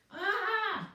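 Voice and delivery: very high, loud cry of exasperation; frustrated yell. A narrator's reading. Virgo yelling at the useless parchment, exasperated. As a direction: let out a furious yelp. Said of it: "yelled Virgo".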